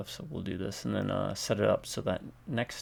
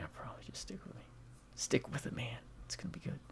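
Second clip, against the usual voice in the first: whispering; 6 semitones higher, 12 dB quieter than usual. Real, not acted. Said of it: "I'll get better with the voice acting".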